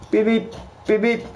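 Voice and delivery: high mock car horn beeping; horn beeps. Honking horn at girl.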